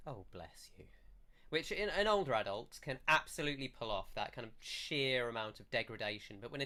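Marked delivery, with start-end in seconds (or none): 0.1-0.8 soft, low, breathy pitying aside; condescending pity. Acted. Villager pitying the newcomers like a patronising adult, hushed condescension. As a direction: murmur it like an adult patronising a child. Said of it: "the look that o-, adults sometimes give of".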